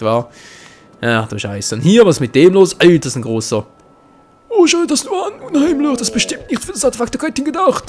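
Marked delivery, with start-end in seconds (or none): 4.5-7.9 high, awed character voice reading the text; voiced game character. Let's player reads the game character's lines aloud, high and awed. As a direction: lift the voice high and wonder at it.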